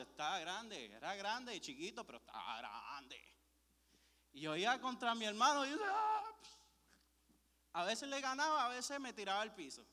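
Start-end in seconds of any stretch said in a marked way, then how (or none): 5.8-6.3 high, loud yell as he charges in; loud, high-pitched war cry; battle yell. Retold or imitated. Charging at his brother.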